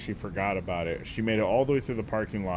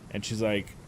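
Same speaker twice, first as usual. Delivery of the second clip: ordinary talk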